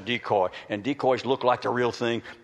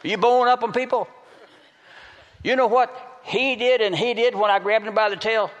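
high, loud challenge to the room; forceful preaching; 10 semitones higher, 4 dB louder than usual. Real, not acted. Preacher challenges people about bowing up, high and forceful. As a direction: throw the question out high and hard.

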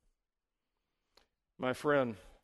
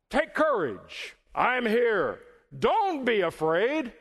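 raised, emphatic preacher's call; calling out boldly; 7 semitones higher, 4 dB louder, slower than usual. Acted. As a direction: cry it out slowly over a storm. Preacher voices Jesus calling out in storm.

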